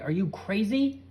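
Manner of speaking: loud high exasperated yell. A narrator's reading. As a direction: shout in disbelief. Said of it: "yelled Carrie".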